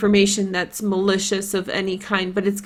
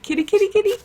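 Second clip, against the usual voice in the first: put-on very high Polish-accented cat call; Polish kitty call; 11 semitones higher, much faster than usual. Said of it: "You can hear that I'm Polish when I'm saying it".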